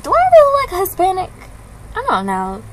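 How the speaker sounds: high, fast disbelieving question; incredulous. Real, not acted. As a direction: toss it out in quick disbelief.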